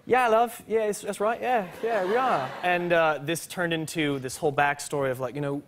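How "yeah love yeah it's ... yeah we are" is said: put-on English accent, pitched high; British accent. Teen fibs to the lady at the gate in a British accent. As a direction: play along as a casual Londoner in a British accent. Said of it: "we were just talking this British accent".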